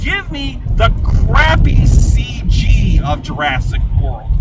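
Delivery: ranting about pretentious films, loud and defiant; passionate rant. Real, not acted. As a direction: declare it proudly and loudly. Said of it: "yes, I'm gonna say it".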